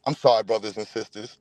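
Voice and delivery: mock apology. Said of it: "Let me bring it back".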